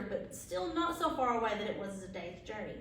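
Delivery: usual speaking voice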